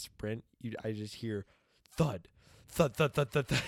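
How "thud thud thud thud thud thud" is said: loud, high thud sounds; thudding like a kid tumbling down stairs; imitated thuds. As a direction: bang out each falling thud.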